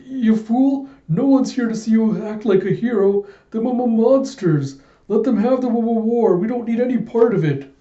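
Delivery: shrill panicked sidekick scolding; high pitched, urgent scolding; high frantic yelling. Acted. Sidekick yelling at departing partner.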